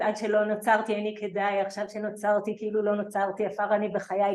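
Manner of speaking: quick recited liturgy; rapid recitation. Shown as a demonstration. Citing Rava's words from Brachot to the audience, fast recitation. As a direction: rattle off the Hebrew prayer fast.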